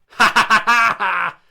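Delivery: loud, slow ha ha ha; big hammy laugh. Real, not acted. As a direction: cackle at your own joke. Laughing at his own gross joke.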